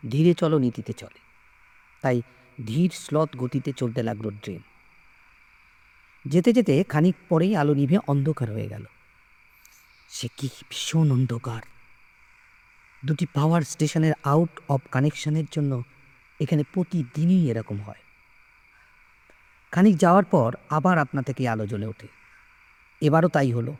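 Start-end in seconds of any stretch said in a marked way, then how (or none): none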